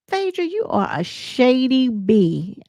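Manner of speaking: slow, drawn-out sassy dig; slow mocking accusation; shady put-down. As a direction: lean into the insult slowly. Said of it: "So Toya said".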